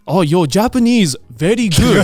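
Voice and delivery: put-on complimenting in Japanese-accented English; imitated Japanese accent. Young Japanese guy praising customer, imitated Japanese accent. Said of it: "in English, he was just like".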